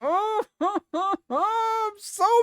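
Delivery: laughing. Real, not acted.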